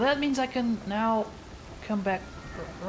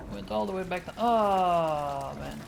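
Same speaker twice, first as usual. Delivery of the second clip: ordinary delivery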